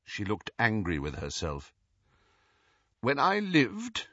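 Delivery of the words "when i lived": slow, higher, stiff formality. A narrator's reading. Ghost reveals who she was, stiffly. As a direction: hold yourself stiffly as you begin. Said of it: "she said stiffly".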